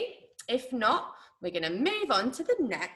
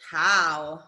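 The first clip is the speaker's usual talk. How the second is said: ordinary talk, nothing marked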